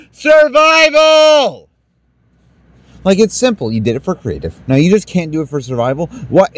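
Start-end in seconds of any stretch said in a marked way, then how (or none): 0.1-1.7 slow, stretched, higher shout; drawn-out furious yell. Real, not acted. Gamer yelling at his game, furious drawn-out yell. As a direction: drag the word out in rage. Raging at the game not saving.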